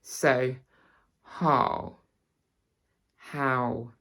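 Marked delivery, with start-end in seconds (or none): 1.2-2.0 'how' with an RP vowel; RP accent. Shown as a demonstration. Teacher contrasting RP with her own accent, RP accent. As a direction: RP accent, placed on the bottom lip. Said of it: "show you the difference between".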